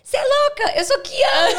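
high, loud mock-indignant little kid; dog's child voice. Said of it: "E ela respondeu". (voz de criancinha indignada, aguda e alta)

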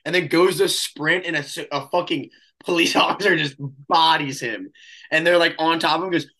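usual speaking voice

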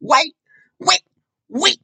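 high-pitched rapper ad-libs; tiny high voice, each word popped out alone. Retold or imitated. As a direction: squeak out short, separate ad-libs. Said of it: "Those fucking ad-libs, you know [name]".

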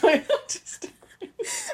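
laughing through a joke. Real, not acted. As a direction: giggle while saying it. Joking while laughing.

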